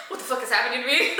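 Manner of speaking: loud, rushed imitation of panic; hammed-up fright; mock scared. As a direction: blurt it fast, playing panicked and half laughing. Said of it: "Like, he's so scared".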